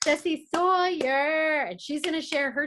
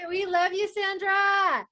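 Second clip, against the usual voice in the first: excited call; 6 semitones higher, 3 dB louder, slower than usual. Real, not acted. Calling out praise to Sandra.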